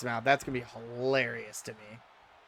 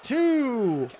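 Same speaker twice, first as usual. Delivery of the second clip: wrestling commentator; 4 semitones higher, slower than usual. Acted. Live wrestling game play-by-play.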